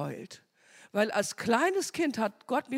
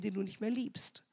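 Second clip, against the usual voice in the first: whispered tempting aside; devil's whisper; 7 dB quieter than usual. Retold or imitated. Devil whispering doubt to the wife about her husband.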